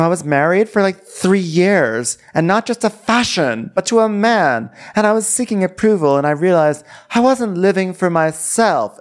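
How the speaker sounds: high-pitched weepy confession; mock tearful. Imitating a divorced woman confessing to friends, high mock tearful voice. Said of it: "Are you crying?".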